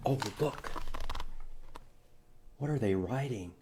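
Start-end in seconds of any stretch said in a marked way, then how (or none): none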